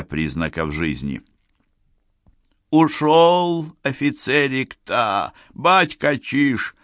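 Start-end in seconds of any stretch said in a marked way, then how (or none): 2.7-6.7 high, slow breathless report; drawn-out, high-pitched panting report; out of breath. A narrator's reading. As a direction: report to the boss, winded. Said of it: "запыхавшись, сообщил один".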